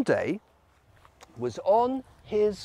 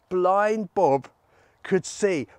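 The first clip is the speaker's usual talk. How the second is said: lively, emphatic good news; celebrating; 4 dB louder, slower than usual. Acted. Storyteller celebrates Bob's healing, excited. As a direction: announce it with delight.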